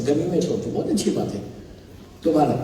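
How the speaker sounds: normal talking voice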